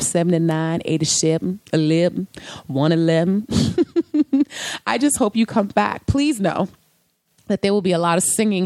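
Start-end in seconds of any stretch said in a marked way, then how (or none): 3.5-4.8 high giggle; short, high-pitched laugh. Real, not acted. Laughs after counting episode numbers.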